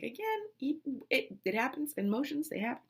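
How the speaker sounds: soft, high squeak settling down; meek, halting self-defense; small tearful excuse. Retold or imitated. Mother answering baffled husband, imitated meek voice.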